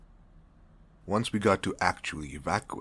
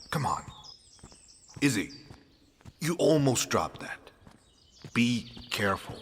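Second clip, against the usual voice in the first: raised, higher-pitched reprimand; scolding boss; 6 semitones higher, 5 dB louder than usual. Acted. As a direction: sharply scold a clumsy worker, loud and high. Boss scolds a clumsy worker.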